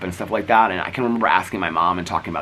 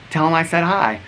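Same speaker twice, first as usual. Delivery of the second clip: put-on higher, sweet child voice; higher innocent little girl; little girl Scout; 10 semitones higher, 3 dB louder than usual.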